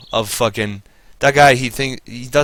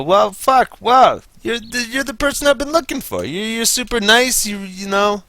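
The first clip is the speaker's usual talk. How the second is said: much higher, starry-eyed swoon; mock gushing; 10 semitones higher than usual. Meeting an amazing nice person, mock gushing swoon. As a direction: gush over them like you found the one.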